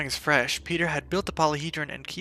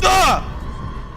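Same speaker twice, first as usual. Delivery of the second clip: sharp much higher, louder yelp; startled yelp; 8 dB louder than usual. Real, not acted. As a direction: yelp in real fright. Startled by enemy in game.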